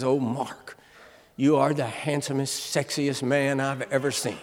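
soft, low, adoring murmur; tender wife. Retold or imitated. Wife sweetly praising her husband as he leaves, tender. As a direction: coo it to him lovingly. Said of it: "she puts her little hands on my face and she says".

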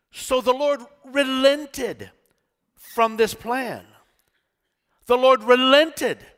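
high, loud, slowed fervent delivery; preaching at full force. Acted. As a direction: preach it like the judgment is coming. Preaching the Amos passage.

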